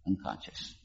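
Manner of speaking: ordinary talk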